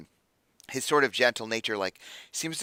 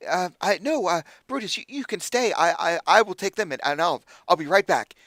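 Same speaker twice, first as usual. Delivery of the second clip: higher, hesitant, crestfallen protest; dejected; 7 semitones higher, 4 dB louder than usual. Acted. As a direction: put on a brave face, stammering. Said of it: "Like, he's very sad. He said".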